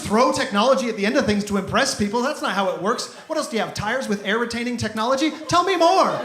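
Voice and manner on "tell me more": mock eager interest. Sarcastic high mock interest.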